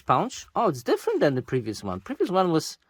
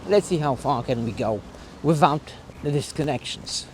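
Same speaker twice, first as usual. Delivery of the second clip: normal talking voice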